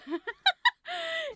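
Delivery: a high, helpless burst of laughter. Real, not acted. Laughing at own Lego fail.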